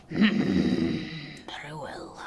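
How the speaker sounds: slow hushed giant reply; slow whisper. A narrator's reading. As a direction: breathe it out slowly. Giant answers slowly.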